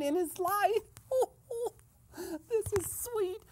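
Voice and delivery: high, squealing glee; gleeful laughing. Pretend. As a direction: laugh through the words in delight. Gleeful reaction to overheard gossip.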